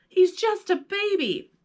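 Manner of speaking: put-on high pleading boy's voice; begging. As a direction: plead for the little creature. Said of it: "P J begged".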